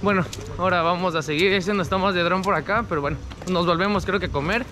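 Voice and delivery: speaking behind cloth mask; mask-covered mouth talking; through a face mask. Real, not acted.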